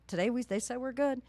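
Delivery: ordinary delivery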